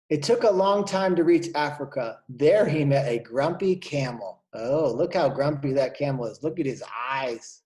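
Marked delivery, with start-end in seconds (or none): none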